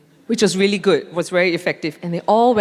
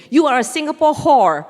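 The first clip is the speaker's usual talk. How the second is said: loud, higher sneering insult; mimicking an angry insult; 5 semitones higher, 5 dB louder than usual. Furious man insulting her as she passes, loud mock sneer. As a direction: spit it at her as she walks past. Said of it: "he turned around and he said".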